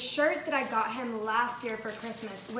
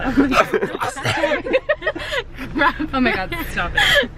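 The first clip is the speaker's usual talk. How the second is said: squealing with laughter; can't stop laughing; laughing hard; 5 semitones higher, 8 dB louder, slower than usual. Real, not acted. Cracking up.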